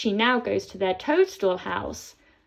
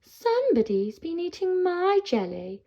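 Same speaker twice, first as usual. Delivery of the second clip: higher, a lightly voiced mother troll; Mummy Troll; 8 semitones higher than usual. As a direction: mum troll, surprised and put out. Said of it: "Mummy Troll says".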